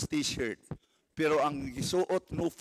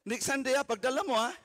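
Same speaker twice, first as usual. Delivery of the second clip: urgent plea; 7 semitones higher, much faster than usual. Real, not acted. Urging congregation to bring guests.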